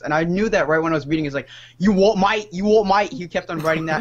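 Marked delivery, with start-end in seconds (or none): 1.8-3.1 loud mock Cockney, higher and louder; Cockney "you what, mate". Mocking a Cockney troll who wrote this at him. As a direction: bark it like a London geezer. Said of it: "talked exactly like one of those Cockney accents".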